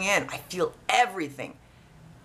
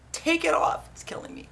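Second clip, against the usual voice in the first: high cry sinking to a near whisper; mock agony, pitched up then voiceless; retold pained plea; 10 semitones higher than usual. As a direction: beg in pain, voice giving out at the end. Retold plea at painful clamp.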